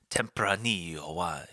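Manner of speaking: put-on light Italian accent on an ad read; a hint of Italian accent selling wine. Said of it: "I went to the Italian accent there".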